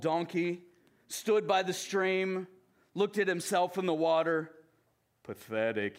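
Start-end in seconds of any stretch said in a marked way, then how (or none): 5.2-6.0 gloomy Eeyore. Acted. Eeyore voiced in read story.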